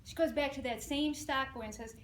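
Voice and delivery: normal talking voice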